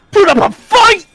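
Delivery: high, loud challenge; taunting shout. Acted. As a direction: bellow the challenge.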